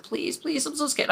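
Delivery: put-on high, hushed plea of a scared child; frightened child pleading. Scared child begging dad to stay in the car, pleading. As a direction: plead small and high, as if near tears. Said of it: "I was crying".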